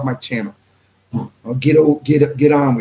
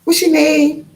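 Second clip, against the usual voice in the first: much higher, mock-curious question; asking the name in a high put-on voice; imitating the questioner; 14 semitones higher, slower than usual. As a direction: pitch it up high to play the other man. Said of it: "a Messianic Hebrew said".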